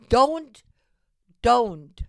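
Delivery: urging viewers, a loud sharp don't; emphatic don't. Real, not acted. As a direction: hit it like a warning. Urging viewers not to buy.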